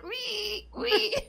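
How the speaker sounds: high wheezy squeal like rickety bellows; high-pitched imitation of a squeaking prop; imitated prop squeak. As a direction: squeak like a creaky old machine. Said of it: "And it's like".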